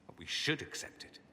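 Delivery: nearly whispered admission to the Inquisitor; hushed. Acted. Dorian half-whispers to the Inquisitor about his homeland, hushed. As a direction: breathe it out, almost unvoiced.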